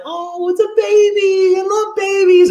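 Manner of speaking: put-on a lovestruck fan squealing over the baby; mock gushing fan. Mocking female fans gushing, high falsetto squeal. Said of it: "instinctive desire within females".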